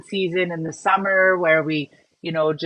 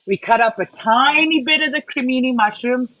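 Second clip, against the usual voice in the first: ordinary talk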